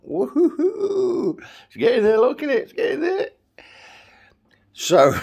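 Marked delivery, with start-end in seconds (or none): 0.1-3.3 very high falsetto squeal; playful cheering. Pretend. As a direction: squeal it up high in mock celebration. Playfully showing off growing hair.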